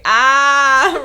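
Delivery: loud, drawn-out "ah"; big "ah". Acted. Co-host reading out a scribbled note, big "ah". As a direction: blurt a big mock yell. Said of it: "noticed a big".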